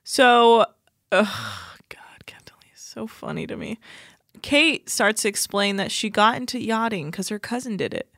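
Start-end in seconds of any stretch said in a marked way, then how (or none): 1.9-3.8 soft amused whisper. Real, not acted. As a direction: whisper it like a secret. Whispered aside.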